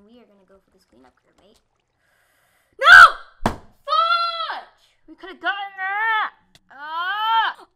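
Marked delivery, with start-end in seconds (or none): 2.8-6.3 high, very loud, drawn-out howl; frustrated scream. Real, not acted. Gamer screaming over a lost chance, frustrated. 6.7-7.7 high, loud yell of defeat; frustrated scream. Real, not acted. Gamer after losing the match, frustrated scream.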